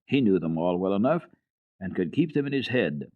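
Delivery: ordinary delivery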